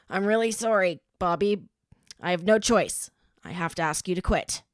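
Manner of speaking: a stern coach letting her go; stern teacher voice. A narrator's reading. Teacher dismissing student.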